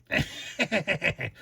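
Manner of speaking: put-on silly cackle in a character voice; hammed-up figure laugh; terrible goofy laugh. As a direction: fake a bad cartoon laugh. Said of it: "that laugh was terrible".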